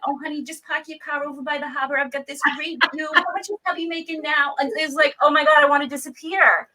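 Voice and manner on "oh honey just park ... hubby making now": put-on grandma's thick Boston accent, a bit higher; nosy old Boston lady prying in a Boston accent. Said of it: "with the worst Boston accent".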